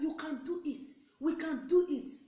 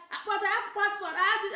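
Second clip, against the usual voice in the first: mock protest; 9 semitones higher, 8 dB louder than usual.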